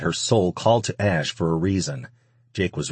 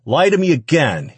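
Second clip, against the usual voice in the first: loud, high, angry challenge; threatening dare; 9 semitones higher, 7 dB louder than usual. A narrator's reading. Man threatening the woman with his fist, threatening dare. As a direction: dare her to try it. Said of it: "he dared her".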